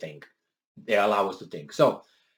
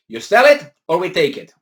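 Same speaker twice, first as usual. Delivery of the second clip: mock ultimatum; 5 semitones higher, 8 dB louder than usual. Voicing German government ultimatum.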